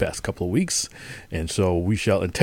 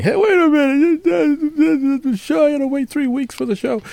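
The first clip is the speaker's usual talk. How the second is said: mocking complainer. Host imitating a complaining listener, high mocking voice. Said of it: "if you mock them".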